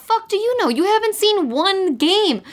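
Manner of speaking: high, exasperated mock disbelief; shrill-sounding retort, high and disbelieving; incredulous outburst. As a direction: squeak it out like you can't believe her. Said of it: "And I'm like".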